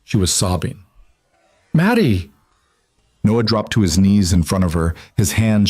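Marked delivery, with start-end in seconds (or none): 1.7-2.3 relieved cry. Acted. Father finds lost daughter.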